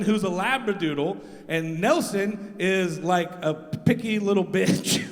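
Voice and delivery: ordinary talk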